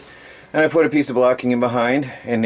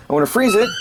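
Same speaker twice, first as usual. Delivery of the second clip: excited; 9 semitones higher, much faster than usual. Real, not acted. Excited about freezer.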